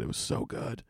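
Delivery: whispered in awe; a whispered rave about clam chowder; awed whisper. Real, not acted. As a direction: breathe it out, savoring the taste. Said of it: "Holy fucking shit".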